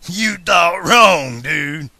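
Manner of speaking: loud, drawn-out villain retort; slow gloating outlaw challenge; menacing taunt. A narrator's reading. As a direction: throw it back slowly and loudly. Villain's taunt before firing.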